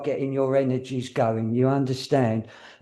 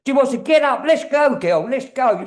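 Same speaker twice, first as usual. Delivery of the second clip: high, louder eager urging; excited spirit; 11 semitones higher, 6 dB louder than usual. Retold or imitated. Medium voicing the excited spirit lady urging her on. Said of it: "She had this excitement about her".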